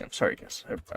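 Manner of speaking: unvoiced, soft apology; whispered apology. Real, not acted. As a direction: whisper sorry to the audience. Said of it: "I'm aggressive today".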